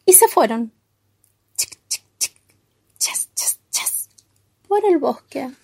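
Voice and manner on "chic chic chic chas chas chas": soft voiceless clicks, then swishes; hushed mouth-made percussion; imitated rattle and rainstick. A narrator's reading. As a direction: whisper the shaker noises in rhythm. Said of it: "no te olvides de hacerla sonar".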